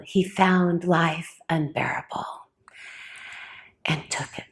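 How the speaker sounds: quiet and grave, fading out at the end; hushed grief. Real, not acted. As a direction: voice sinking almost to a whisper. Tells of her son's suicide.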